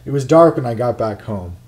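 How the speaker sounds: plain reading voice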